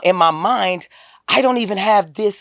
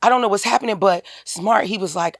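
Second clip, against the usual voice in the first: ordinary talk, nothing marked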